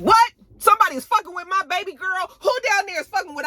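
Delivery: high-pitched, fast protective outburst; acted outrage.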